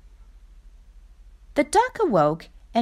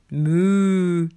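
low lowing moo; a lightly voiced cow; 4 semitones lower than usual. A narrator's reading. Cow speaks.

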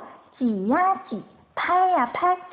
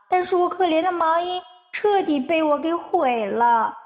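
sad crying; 6 semitones higher than usual. A narrator's reading. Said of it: "阿尔菲伤心地哭了起来".

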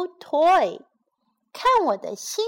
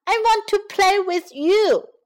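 higher, pushing the word want; bright character line; 9 semitones higher, 4 dB louder than usual. Acted. Teacher voicing the elephant, asking to play. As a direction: call to a friend to come and play.

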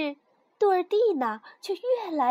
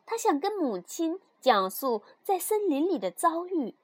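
usual speaking voice

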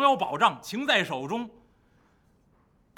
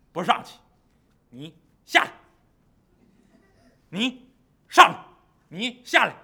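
stubborn monster; 5 dB louder than usual. Acted. River monster refusing to come ashore, stubborn retort. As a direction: refuse like a sulky beast.

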